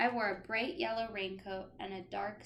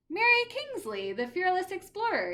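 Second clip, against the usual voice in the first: higher and louder, grand; proud explanation; 9 semitones higher, 8 dB louder than usual. Acted. Teacher explaining the explorer to a child, grandly. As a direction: announce the explorer with flair.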